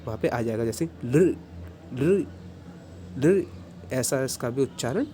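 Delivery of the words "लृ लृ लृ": लृ with a light la from the same place as ऋ; touch the tongue behind the teeth, lightly la; vocalic lṛ. Shown as a demonstration. Teacher repeating the vocalic लृ for the class. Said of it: "थोड़ा हल्का सा ला करके आप बोलेंगे".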